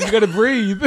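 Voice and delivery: high, loud laughing plea; laughing at a friend holding his breath. Real, not acted. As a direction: crack up while urging him.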